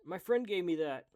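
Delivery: high, very loud acted protest; urgent shout. Retrying the character's plea, high urgent shout.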